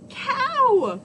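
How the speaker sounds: usual speaking voice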